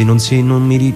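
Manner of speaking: ordinary talk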